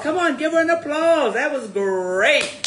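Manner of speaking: rally the audience to clap; cheering on the reader to the crowd; excited host. Real, not acted. Host urges audience applause.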